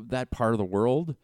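ordinary delivery

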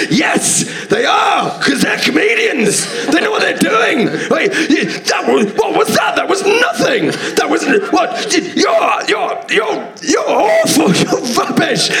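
very high, shouted rant with laughter breaking through; furious heckler. Retold or imitated. Angry punter ranting at comedian after the show, very high mock shout. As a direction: rant like an incensed punter pointing at the others. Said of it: "he goes".

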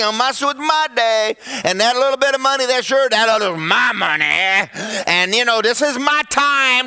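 guard your turf, mock-stubborn; possessive churchgoer. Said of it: "You got your area that".

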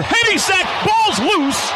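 high shouted alarm; excited call. Real, not acted. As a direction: jump on the fumble. Sack and fumble called.

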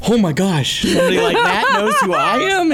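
high, squealing disbelief; starstruck squeal. Retold or imitated. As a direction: gush like a starstruck fan. Retold starstruck reaction.

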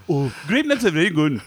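comic mock Indian accent; Indian-sounding accent. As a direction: put on an Indian accent for laughs. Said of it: "Why does he sound Indian?".

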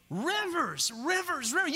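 each "rivers" punched high and falling; repeated emphasis. Acted. Preacher chanting "rivers" to the crowd, punched and high. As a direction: hammer "rivers" three times.

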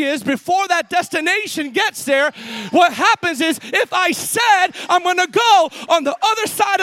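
loud, driving pulpit shout; fiery preaching. Acted. Pastor rousing the congregation about speaking it out, loud fiery preaching. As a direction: preach it out to the whole room.

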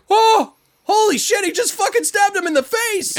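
very high, loud gasp of horror; shocked movie viewer; mock shock. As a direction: audience member yelping in disbelief. Shocked audience reaction.

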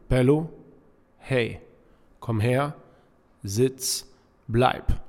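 brief, crisp one-word commands; short dog commands. Shown as a demonstration. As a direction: call each command out briefly with a pause between. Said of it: "Ich sag jetzt extra so eine Kommandos".

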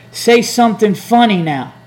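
high, loud, slow mock heckle; heckler's call. Heckler interrupting the comic after a laugh, mock demanding shout. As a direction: holler it at the comic, drawn out. Heckler calls out again, retold.

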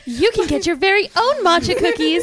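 infomercial voice. Acted. Infomercial pitch in character.

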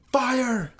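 very high mock alarm call; high-pitched retold holler; retold yelling. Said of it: "I hear him yelling".

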